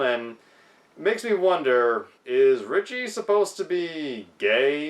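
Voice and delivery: usual speaking voice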